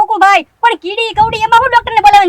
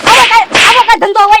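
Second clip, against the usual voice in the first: louder, higher angry protest; indignant retort; 4 semitones higher, 6 dB louder than usual. Acted. Shopkeeper angrily rejects partner's lazy deal, louder. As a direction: throw the offer back at him.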